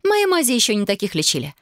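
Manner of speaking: выше, с легкой насмешкой; слегка похвастаться своим умением; Вайолет, со смешком. A narrator's reading. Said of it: "со смешком отозвалась Вайолет". (повыше, чуть насмешливо)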